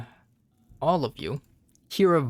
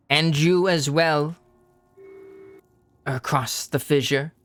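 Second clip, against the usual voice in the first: feminine dragon voice; 3 semitones higher than usual. Acted. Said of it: "somewhat feminine draconic voice".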